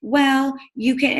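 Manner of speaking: lightly imitating a timid pitch; mock hesitant coach. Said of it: "I'm sure you're like".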